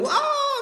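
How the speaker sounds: high mock crying wail; mock child's wail. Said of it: "dia nangis seperti itu". (tangisan anak dibuat-buat)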